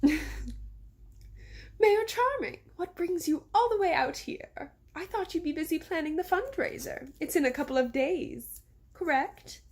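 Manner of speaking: high grandmother voice with a forced smile; Missus Grimm. A narrator's reading. Grandmother greeting the mayor, with forced pleasantness. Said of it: "said Missus Grimm with a forced smile".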